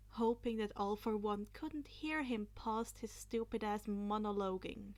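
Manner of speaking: plain reading voice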